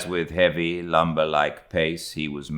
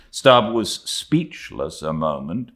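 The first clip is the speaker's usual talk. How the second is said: ordinary delivery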